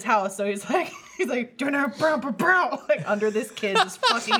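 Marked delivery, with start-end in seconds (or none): 1.6-2.8 vocalized metal riff, higher and louder; slow, pounding mouth-made drum fill; imitated metal drumming. Imitating the metal music under a video, pounding mouth noises. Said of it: "someone put metal music under it".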